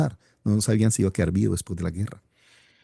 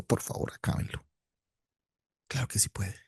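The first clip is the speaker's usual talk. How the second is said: much softer, unvoiced plea; hushed whisper; 10 dB quieter than usual. Real, not acted. Preacher rejects the song in a softer whisper. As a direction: whisper it like a confidence.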